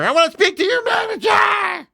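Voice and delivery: high shrill-free mock yell of a whiny customer; mock entitled complainer. Mocking players as whiny customers, high yelled complaint.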